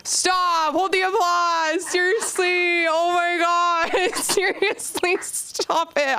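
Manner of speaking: high, slow fake-humble protest to the crowd; mock modesty. Mock modest to imagined applause.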